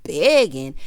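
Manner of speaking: loud, punched mock disbelief; retold scornful echo. Retelling him echoing her taunt, loud mock disbelief. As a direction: throw the word back loudly, imitating him. He throws her word back.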